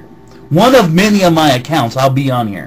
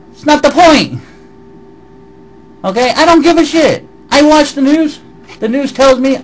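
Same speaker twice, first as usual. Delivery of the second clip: high, loud angry retort; 10 semitones higher, 3 dB louder than usual. Real, not acted. Arguing back at the commenter, high and loud. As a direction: snap back, voice raised high.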